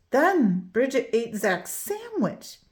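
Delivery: usual speaking voice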